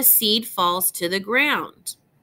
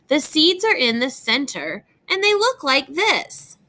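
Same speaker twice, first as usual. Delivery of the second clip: put-on bright, lilting read-aloud voice for young listeners; reading to children; 4 semitones higher than usual. Teacher reads a tree book to young students, gentle storytime reading.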